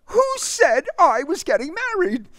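very high, loud demanding cry; angry demand. Acted. Marie demands of the suitor on the stoop. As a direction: demand an answer, furious. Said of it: "she demanded".

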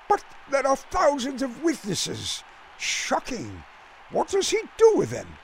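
politely amazed, eager to learn; English gentleman. A narrator's reading. Bland marvels to Jack about the film star, prim and high. Bland misreads stealing bases.

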